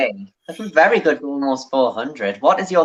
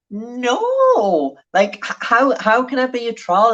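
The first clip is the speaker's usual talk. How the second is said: incredulous; 8 semitones higher, slower than usual. Retold or imitated. Retold own indignant reply.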